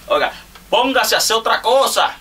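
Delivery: playful loud outburst at a friend; mock exclamation. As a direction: yell it like a fake scolding. Mock scolding friend to quit.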